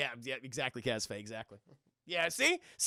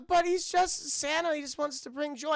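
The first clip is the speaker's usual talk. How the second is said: higher, naive pleading voice; mock pleading; 7 semitones higher than usual. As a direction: plead Santa's case, lightly hammed up. Elves plead Santa's case to kids.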